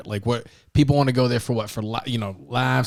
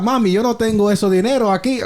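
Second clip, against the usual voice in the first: high, hammed-up Dominican protest; Dominican accent; 10 semitones higher, 4 dB louder than usual. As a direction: haggle in an exaggerated Dominican accent, breaking into laughter. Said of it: "I could do my accent for my country".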